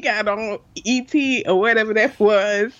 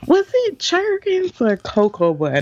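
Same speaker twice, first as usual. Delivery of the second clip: mock nosy question, higher and slowed; ignorant interviewer; 8 semitones higher, slower than usual. Imagining an interviewer asking a silly question, mock voice. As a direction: play the clueless interviewer. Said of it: "I would have said something ignorant like that".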